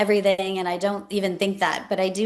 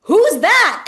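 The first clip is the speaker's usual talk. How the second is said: much higher, louder, stunned question; baffled surprise; 13 semitones higher, 10 dB louder than usual. Retold or imitated. Staffer on election night asking about an unknown winner, stunned. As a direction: shriek out who that is. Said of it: "And I was like".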